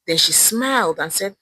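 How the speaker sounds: ordinary delivery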